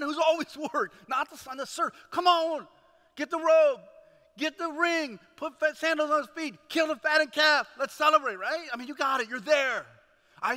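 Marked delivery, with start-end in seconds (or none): 2.1-8.4 loud, high, urgent celebration orders; excited father. Acted. Father ordering the servants to celebrate, excited and loud. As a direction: call out each order with rising excitement.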